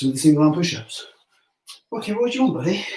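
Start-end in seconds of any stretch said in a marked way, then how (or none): 1.9-2.8 higher, softer sing-song for the dog; dog-talk voice. Real, not acted. Man asking his dog Rocky what it wants, in soft high dog-talk.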